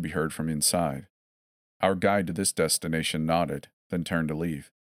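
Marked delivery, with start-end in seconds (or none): none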